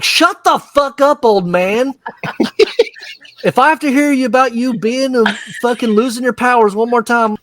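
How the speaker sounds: high-pitched mock exasperation; mock angry outburst. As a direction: act fed up with the old man. Acted character telling off old man.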